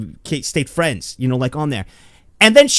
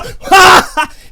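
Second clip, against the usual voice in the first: loud, high crowing laugh; triumphant laugh; 11 semitones higher, 7 dB louder than usual. Retold or imitated. Little Joey celebrating he is back, triumphant laugh. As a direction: burst into a big victory cackle.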